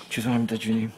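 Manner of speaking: hushed, low apology to God; penitent prayer. Retold or imitated. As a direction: say it low and small, humbled before the Lord. Penitent prayer quoted from himself.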